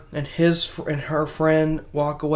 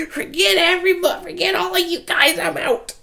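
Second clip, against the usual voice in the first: much higher, quick bratty exit; kid voice; 11 semitones higher than usual. Retold or imitated. Kid abandons everyone, high and fast. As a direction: storm off like a fed-up kid.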